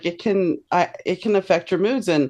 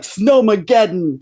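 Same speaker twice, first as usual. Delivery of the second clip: weather hype; 3 semitones higher, 4 dB louder, slower than usual. Retold or imitated. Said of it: "Now it's like".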